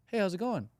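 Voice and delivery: greeting rising high and light, ending up; chirpy lifted greeting, weak and eager; upward inflection. Shown as a demonstration. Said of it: "It's more like".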